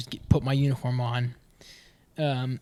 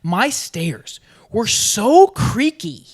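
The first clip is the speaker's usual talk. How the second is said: emphatic; 8 semitones higher, 10 dB louder, much slower than usual. Real, not acted. Stressing the creaky stairs.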